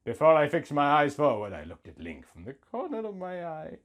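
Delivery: usual speaking voice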